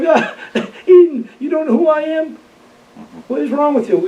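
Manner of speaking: high-pitched mock reproach; retold incredulous taunt. Warrant officer teases the man he fooled, mock incredulous. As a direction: needle him, pitched well up.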